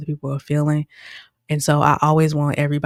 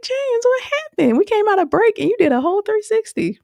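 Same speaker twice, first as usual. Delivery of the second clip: much higher voice, quick and playful; high teasing question. Retold or imitated. To James after the break, a high playful teasing question. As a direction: tease him in a high, amused voice.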